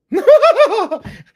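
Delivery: very high, loud cackling laugh; Tucker Carlson laugh. Retold or imitated. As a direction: burst into a shrill mock cackle. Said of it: "my Tucker Carlson laugh".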